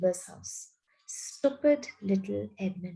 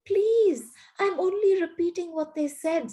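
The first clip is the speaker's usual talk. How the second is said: high, frightened stammer; a boy stammering, high and frightened; 9 semitones higher than usual. A narrator's reading. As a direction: stammer it out, scared. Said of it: "stammered Edmund".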